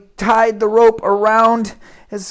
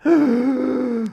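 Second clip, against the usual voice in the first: sharp gasp; 3 semitones higher than usual. Acted. Boy falls down the pipe.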